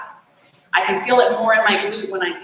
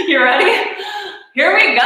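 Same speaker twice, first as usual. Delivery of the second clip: usual speaking voice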